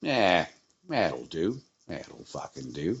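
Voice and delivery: low, fading mock Australian drawl; quiet, low mock Aussie mutter; Australian accent. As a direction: sink down into a lazy Australian agreement. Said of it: "they got Australian accents".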